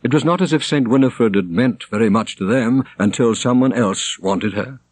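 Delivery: ordinary delivery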